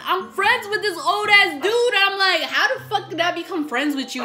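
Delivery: incredulous outburst. Real, not acted. Outraged at old friend.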